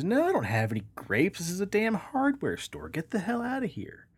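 hammed-up angry shopkeeper; exasperated manager. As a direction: throw the duck out, losing patience. Joke, manager angrier.

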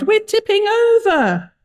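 high-pitched wail of alarm; crying out. A narrator's reading. Sailor as the boat tips over, crying out. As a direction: cry it high, as a panicking sailor. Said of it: "another cried".

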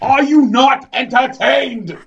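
slow, high, full-throated movie-quote yell; Gladiator shout. Pretend. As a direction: bellow it like a gladiator to the arena. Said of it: "That's what I was hoping would happen".

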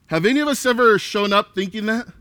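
usual speaking voice

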